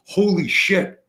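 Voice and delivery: a sudden jolt of fear; shocked exclamation. Retold or imitated. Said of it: "And he goes".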